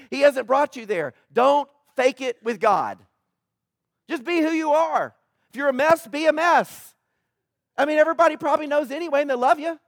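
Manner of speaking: loud, high, impassioned preacher's build; rising preaching fervour; fervent preaching. Acted. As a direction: drive it home from the pulpit. Pastor preaching with force.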